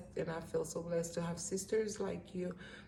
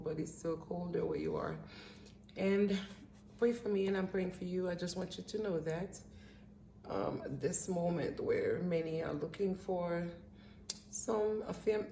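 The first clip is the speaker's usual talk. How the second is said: usual speaking voice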